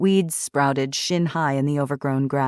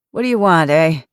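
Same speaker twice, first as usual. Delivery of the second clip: 1 grumpy challenge; 7 dB louder than usual. A narrator's reading. Grumpy old man confronting deputies.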